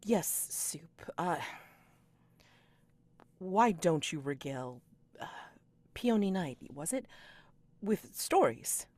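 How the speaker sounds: dry school head. Acted. Sun dryly redirecting Soup.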